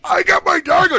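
high, loud triumphant cry; high and loud, thrilled at finally winning; excited. Retold or imitated. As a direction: crow it like a prize won. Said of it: "I'm like".

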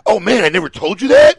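loud, high, amazed exclamation; excited normal person. Acted. Showing how a normal person would react, excited. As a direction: burst out with a story you can't wait to tell. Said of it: "a normal person would be like".

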